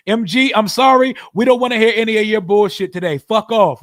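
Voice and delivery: loud, fast scolding of a chat troll; angry scolding. Real, not acted. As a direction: shout the troll down.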